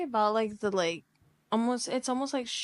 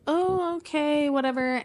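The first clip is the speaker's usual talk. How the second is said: higher, sulky brush-off; mock sulky whatever; 6 semitones higher than usual. Guy sulks at the door after rejection, mock sulky. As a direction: play it hurt but pretending not to care. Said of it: "so he's like".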